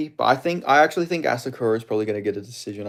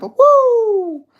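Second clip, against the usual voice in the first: loud falsetto whoop; high screamed woo; 10 dB louder than usual. Acted. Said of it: "You always gotta add the woo".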